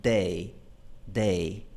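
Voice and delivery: day with a crisp English alveolar d. Shown as a demonstration. Teacher demonstrating the English d in day, softly. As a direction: tap day with the tongue behind the teeth. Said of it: "la pronunciación, por ejemplo, de".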